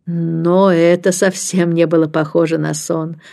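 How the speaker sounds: plain reading voice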